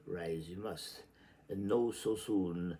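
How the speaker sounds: usual speaking voice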